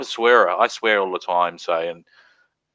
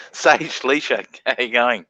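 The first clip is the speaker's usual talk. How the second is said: usual speaking voice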